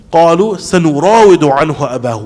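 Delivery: quick melodic Quranic recitation; تلاوة قرآنية سريعة. Shown as a demonstration. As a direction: recite the verse in Arabic, quickly. Quran recitation in tafsir class.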